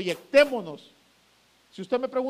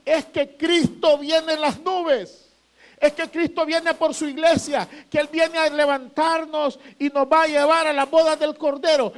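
higher and louder, building to a shout; a pastor shouting the Second Coming to his flock; shouted preaching; 5 semitones higher, 5 dB louder than usual. Real, not acted. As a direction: proclaim it at full force. Preacher climaxes about Christ's return.

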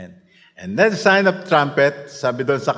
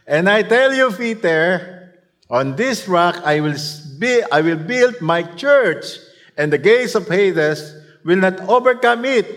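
lightly raised, proclaiming scripture voice; quoting Jesus; 6 semitones higher, 5 dB louder than usual. Retold or imitated. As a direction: declare the verse like Jesus. (bahagyang malakas na pagbigkas ng talata)

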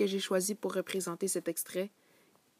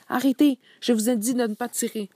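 higher and much louder shouted order; furious shout; 4 semitones higher, 10 dB louder than usual. Acted. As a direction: yell at the officers to stop firing. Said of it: "Boulard trouva assez de souffle pour crier". (ordre crié très fort, plus aigu)

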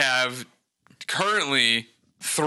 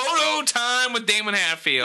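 announcer intro; 7 semitones higher, slower than usual. Acted. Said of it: "show segment intro".

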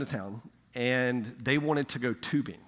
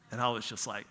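ordinary delivery

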